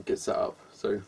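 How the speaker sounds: ordinary talk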